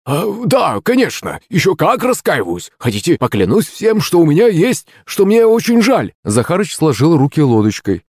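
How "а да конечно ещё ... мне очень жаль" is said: high, whiny, overeager pleading; eagerly penitent servant. A narrator's reading. Servant assures his master he repents, eagerly pleading. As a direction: swear remorse in a high, ingratiating voice.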